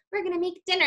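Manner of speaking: put-on high, light little-girl voice for the dolls; daughter's doll play voice. Mother imitating her daughter's doll dinner scene, high and light. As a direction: voice the dolls like a toddler playing house. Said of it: "she has them act out".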